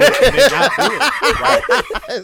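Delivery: loud, high, slow laughing talk. Real, not acted. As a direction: push the words out through laughter. Laughing rebuttal.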